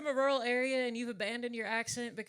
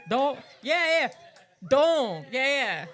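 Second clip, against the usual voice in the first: loud cheering agreement; 5 semitones higher, 12 dB louder, much slower than usual. Real, not acted. Egging on crowd heckler.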